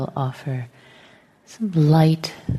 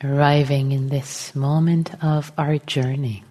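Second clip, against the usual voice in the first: guided meditation; 3 dB quieter than usual. Real, not acted. Teacher settling the group into meditation, calm and unhurried. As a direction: gently guide them into stillness, phrase by phrase.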